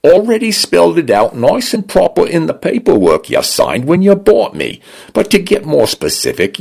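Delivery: high, preachy Cockney voice; lecturing Cockney. A narrator's reading. The home AI lectures its owner in preachy Cockney. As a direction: lay down the law in Cockney.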